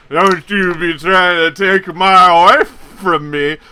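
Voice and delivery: put-on loud, slow slurring drunk threat; drunk jealous warning. Drunk Chad warning the stranger off his fiancée, loud and slow. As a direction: warn him off, sloppy drunk. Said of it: "And then Chad just goes".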